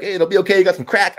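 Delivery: high-pitched strung-out addict offering comfort; strung-out crackhead. Acted. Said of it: "some strung out crackhead".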